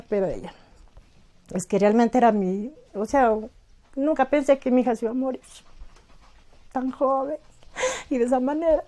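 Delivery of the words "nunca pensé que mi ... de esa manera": higher, strained, near tears; holding back tears over her daughter; voice breaking with grief. Real, not acted. Grieving mother recalls daughter's death.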